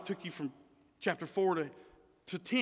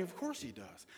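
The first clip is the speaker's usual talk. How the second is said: dismissive; 3 semitones lower, 14 dB quieter than usual. Retold or imitated. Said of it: "Instead of thinking".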